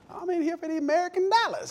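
very high-pitched country accent impression; grandpa's country accent. Grandpa in his junk shop, exaggerated country accent. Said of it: "That's how he talked".

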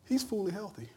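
ordinary talk

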